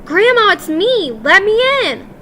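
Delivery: loud, high desperate shout. A narrator's reading. Boy-mouse yelling to his grandmother to open the door. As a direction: yell as loud as you can through the door. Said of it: "as loudly as I possibly could".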